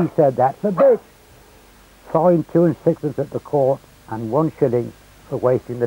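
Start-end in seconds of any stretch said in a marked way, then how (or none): 0.4-1.0 high Hampshire punchline; broad Hampshire accent. Retold or imitated. Villager outwits the sergeant, high-pitched Hampshire accent. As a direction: deliver the cheeky comeback in broad Hampshire. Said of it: "in his broad Hampshire accent".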